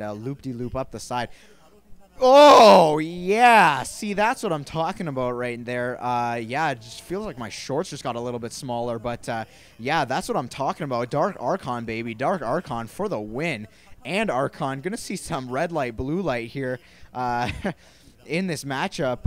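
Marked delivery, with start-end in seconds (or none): 2.2-3.8 loud high "Oh, yeah" whoop; excited cheer. Real, not acted. Caster cheers big play. 3.8-19.3 loud, pumped-up play calling; hyped commentary. Real, not acted. Caster hyped after big play.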